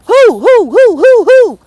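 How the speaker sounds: high, loud owl hoots of "who". Pretend. Getting her owl on at the viewers, loud high hoots. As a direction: hoot each who like an owl. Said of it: "I got to get my motherfucking owl on".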